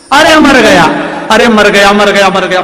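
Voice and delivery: hammed-up pained yelling; husband yelling in pain. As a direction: howl as if struck with a stick. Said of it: "पति चिल्लाए".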